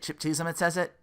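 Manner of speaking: ordinary delivery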